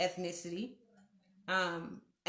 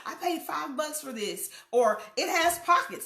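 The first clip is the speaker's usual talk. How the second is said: high, delighted bragging, louder at the end; gleeful boast; 6 semitones higher, 4 dB louder than usual. Acted. Woman showing off her dress, gleeful boast. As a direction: proudly show off a bargain, pitched up.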